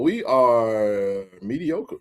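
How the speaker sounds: slow, drawn out verdict; slow drawl. Real, not acted. Telling fans the team is mediocre, slow drawl. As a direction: stretch it out, deadpan.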